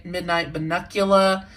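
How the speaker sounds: ordinary delivery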